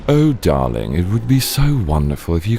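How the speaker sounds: affected upper-crust pleading; posh darling. Acted. Asking darling to share their plate, affected posh voice. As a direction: put on a posh, gushing 'darling' voice. Puts on a genteel character.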